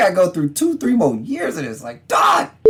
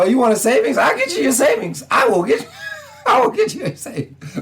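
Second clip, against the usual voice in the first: high, teasing divine voice breaking into laughter; mock God voice; 9 semitones higher than usual. The Lord answering a fan's prayer, teasing high voice. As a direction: play the Lord gloating, pitched up. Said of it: "The Lord's like".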